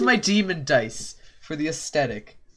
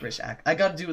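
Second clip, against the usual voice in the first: ordinary talk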